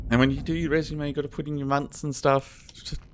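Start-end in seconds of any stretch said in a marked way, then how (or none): none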